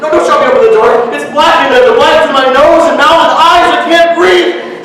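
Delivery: high, loud and fast, choking on fear; panicked shouting. Acted. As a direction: scream it as the dark closes in. Boy shoved into dark cupboard.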